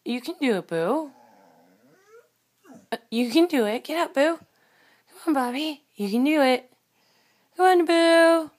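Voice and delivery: rally the lazy dog off the couch, rising each time; cheering the dog on. Real, not acted.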